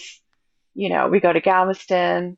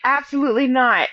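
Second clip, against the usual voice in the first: normal talking voice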